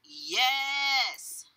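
high, drawn-out yes; stubborn insistence. A narrator's reading. Cashier contradicts the father, stubborn. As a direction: hold your ground in a squabble.

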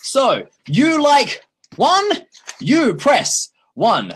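slow, high, simplified broken English; slow pidgin English, each word separate; broken English for learners. Shown as a demonstration. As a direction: spell it out slowly like to a learner. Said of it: "you would say".

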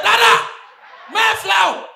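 high loud excited call; shouting for dad. Retold or imitated. Calling out to rich dad.

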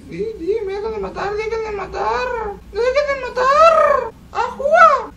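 strange squeaky high-pitched voice, whiny and teary; odd high squeak while gaming; weird high voice. Real, not acted. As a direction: talk in a strained high whine. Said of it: "No sé por qué está mi voz así".

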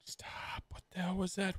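hushed whisper of fear; scared whisper. Real, not acted. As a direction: whisper it, afraid to be heard.

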